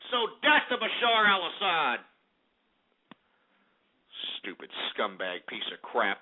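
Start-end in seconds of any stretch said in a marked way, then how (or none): 4.4-6.2 disgusted mutter. Real, not acted. Radio host grumbling about the dictator, low and soft. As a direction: grumble it under the breath. Disgusted aside after rant.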